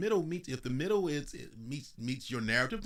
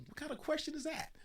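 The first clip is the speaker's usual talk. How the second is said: high, softer puzzled retort; retold reply; 4 dB quieter than usual. Speaker answering his wife, high puzzled mock reply. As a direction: toss the question back, baffled. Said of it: "I said".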